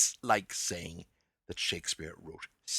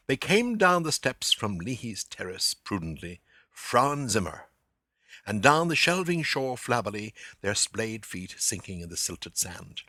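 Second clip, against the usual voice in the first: louder, savouring reading of prose; literary reading; 7 dB louder than usual. Acted. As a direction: read it aloud, relishing the sounds. Reads the passage again.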